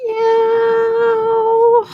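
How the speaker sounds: high little kitten meow. Acted. Teacher voicing the gray tabby kitten, a high mew. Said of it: "Hello, little gray tabby kitten".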